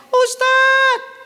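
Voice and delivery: very high, loud, hammed-up cry; mock wailing. Wife calling to her ustadz, mock wailing. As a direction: cry out for help in a fake wail.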